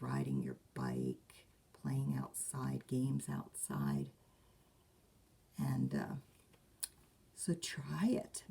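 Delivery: soft whisper. Real, not acted. Gentle suggestions to children.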